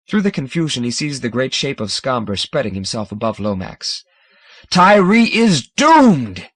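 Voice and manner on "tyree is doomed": slow, loud and high proclamation; thunder. A narrator's reading. Scomber thundering to the fathers, slow and loud. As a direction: thunder the doom to all. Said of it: "he thunders".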